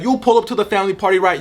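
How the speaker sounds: ordinary talk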